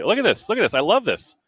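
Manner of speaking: high-pitched excited exclaiming; real delight. Real, not acted. Commentator loving the teamwork on the obstacle, delighted. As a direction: marvel at it, voice jumping up.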